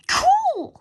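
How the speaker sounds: high, loud delighted cool. A narrator's reading. George thrilled by the idea, high loud glee. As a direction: squeal it with glee.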